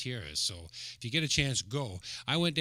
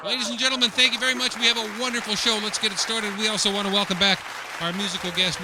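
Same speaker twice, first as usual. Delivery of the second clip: loud, high showman announcement; showman host; 7 semitones higher, 6 dB louder than usual. Acted. Host kicking off the show for the audience, loud and high. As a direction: sell the opening to the audience. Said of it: "host opening the show".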